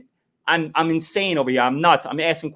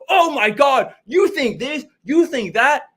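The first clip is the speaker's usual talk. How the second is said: high put-on scoffing voice, much higher; mocking imitation; 8 semitones higher, slower than usual. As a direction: ham up the fake outrage, high. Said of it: "Anything I ask you".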